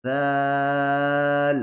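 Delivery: দাল held very long and slow; three alif stretch. Shown as a demonstration. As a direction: draw দাল out steadily. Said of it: "যেখানে তিন লেখা আছে সেখানে তিন আলিফ".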